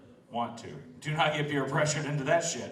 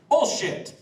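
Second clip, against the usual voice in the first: much higher and louder, a sudden yell; outraged shout; 9 dB louder than usual. Acted. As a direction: blow up at the tongue twister. Mock outrage at the rhyme.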